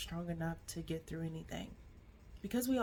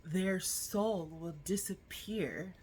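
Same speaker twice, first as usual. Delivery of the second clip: ordinary talk, nothing marked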